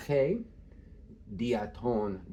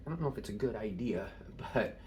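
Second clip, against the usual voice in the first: whisper; 3 semitones lower, 7 dB quieter, faster than usual. Real, not acted. Aside while explaining the page.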